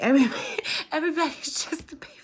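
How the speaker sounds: high, laughing squeal of affection. Real, not acted. Tarot reader to viewers, laughing high and loud. Laughing through emotional words.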